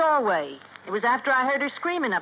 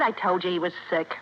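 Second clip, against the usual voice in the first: ordinary talk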